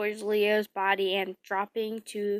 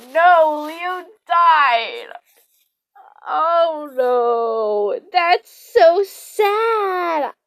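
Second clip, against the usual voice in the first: real upset, louder, voice breaking; near tears; 5 semitones higher, 7 dB louder, much slower than usual. Real, not acted. Reader upset that Leo died, breaking voice. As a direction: cry out in genuine dismay. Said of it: "I feel like I gotta cry".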